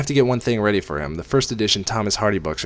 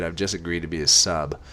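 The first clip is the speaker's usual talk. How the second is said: ordinary delivery, nothing marked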